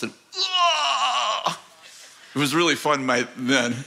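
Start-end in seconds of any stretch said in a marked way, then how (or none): 0.4-1.6 high-pitched mock scream; imitated attacker's yell. Possessed man swings at the pastor, high mock scream. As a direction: mimic a wild demonic cry.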